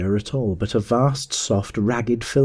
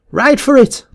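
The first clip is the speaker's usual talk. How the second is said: very high, loud yell; shouting; 7 dB louder than usual. A narrator's reading. Little man urging riders to flee, shouting. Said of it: "the little man was shouting".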